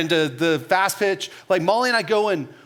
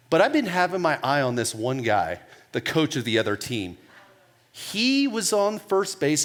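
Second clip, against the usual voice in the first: ordinary talk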